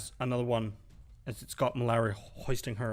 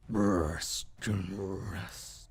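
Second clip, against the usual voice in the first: slow, drawn-out guttural creature growl; 3 semitones lower, much slower than usual. Acted. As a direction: growl it out very slowly from the throat. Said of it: "you just hear this guttural".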